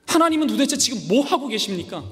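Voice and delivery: the prophet complaining aloud to God, high and loud; Habakkuk's complaint. Acted. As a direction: cry out in frustration at God. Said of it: "하박국 선지자가 이렇게 물어보는 거예요".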